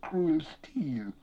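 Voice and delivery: high, wailing, drawn-out plea; wretched high keening with whispered breaks; weeping, whimpering creature. A narrator's reading. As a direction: whine and beg pitifully, breaking into whispers. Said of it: "he wept".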